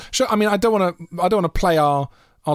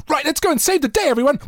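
high, eager rallying call; rally everyone in a high-pitched hero voice; superhero dog. Acted. Dog Man rallying everyone to save the day, high heroic call. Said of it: "So he'd be going".

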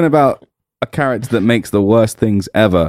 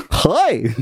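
Barney impression; 7 semitones higher than usual. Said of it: "That's a really good Barney impression".

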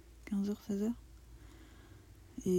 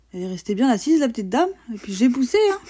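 louder, higher teasing complaint; mock indignation; 4 semitones higher, 9 dB louder, faster than usual. Real, not acted. Teasing the partner who stayed seated, mock indignation. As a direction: complain playfully with raised voice. Teases partner about sitting while pushing.

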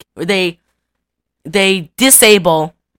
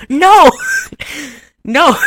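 high, loud mock-insistent no broken by laughter; the wife refusing, hammed up; 9 semitones higher, 4 dB louder than usual. Wife contradicts her husband, high laughing no. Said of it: "she goes".

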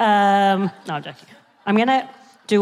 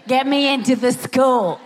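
bratty sister voice; 7 semitones higher than usual. Retold or imitated. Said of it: "She's like".

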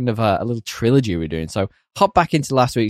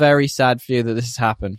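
ordinary delivery

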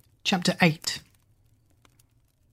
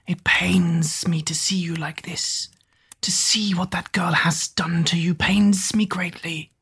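hushed fawning lizard servant; whispery servant; 2 semitones higher, 3 dB quieter, slower than usual. Acted. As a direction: whisper with oily devotion. Googe's fawning voice.

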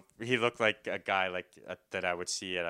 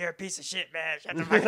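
put-on drunk shouting; 7 semitones higher, 3 dB louder, faster than usual. Drunk man shouting down his rowdy cellmate: loud laughing yell. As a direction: bellow it, drunk and cocky, cracking up.

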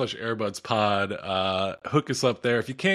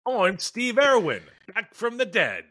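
high-pitched mock Australian TV character; hammed-up Australian voice, pitched way up; Steve Irwin; 9 semitones higher, slower than usual. Comic announces a return from the dead, mock Australian voice. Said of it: "when you do voices".

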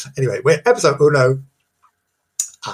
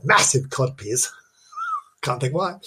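ordinary talk

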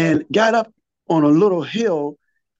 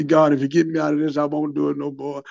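hurried prayer; faster than usual. Retold or imitated. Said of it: "I done asked God so many times".

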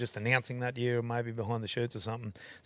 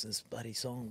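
ordinary delivery

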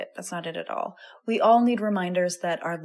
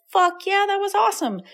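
much higher and louder whoop of delight; excited cheer; 13 semitones higher, 6 dB louder than usual. Retold or imitated. Host imitates a listener loving the exercise, thrilled and loud. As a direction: burst out thrilled like a fan. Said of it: "if you're like".

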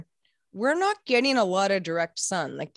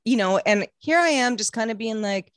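ordinary talk, nothing marked

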